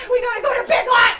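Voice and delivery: fast loud urgent shout. Acted. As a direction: blurt it out urgently. Improv character shouting urgently.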